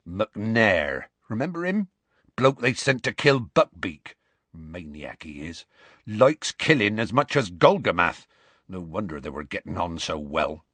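rustic voice with muttered asides; Hagrid, scornful. Acted. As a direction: describe the villain with distaste. Hagrid describes McNair.

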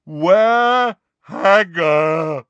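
slow, loud, lumbering roar; roaring giant. A narrator's reading. Giant demands his missing friend, slow roar. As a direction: roar out each word slowly. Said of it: "roared Grawp".

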